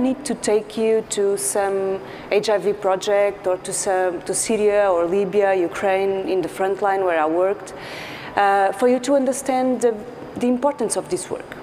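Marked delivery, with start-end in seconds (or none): none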